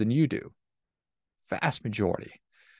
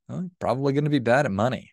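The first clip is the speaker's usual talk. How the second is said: normal talking voice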